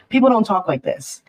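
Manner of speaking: ordinary talk, nothing marked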